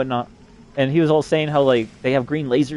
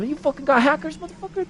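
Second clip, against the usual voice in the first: crazy trash talk; 14 semitones higher than usual. Retold or imitated. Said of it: "'Cause I always talk like that".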